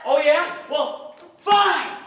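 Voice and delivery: high, loud, defiant shout; furious retort. Acted. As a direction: shout back, wounded pride and all. Acted quarrel, he storms back.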